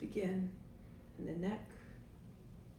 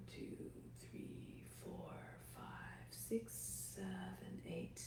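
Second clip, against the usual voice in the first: whispered counting; 11 dB quieter, slower than usual. Acted. Counting breaths during slow exhale.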